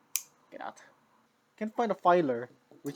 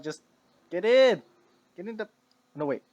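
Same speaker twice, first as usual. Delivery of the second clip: ordinary delivery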